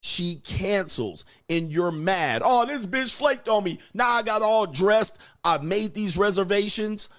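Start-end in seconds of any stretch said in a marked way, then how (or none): 2.4-7.1 mock angry rant. Man mocks angry stood-up date.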